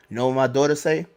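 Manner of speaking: usual speaking voice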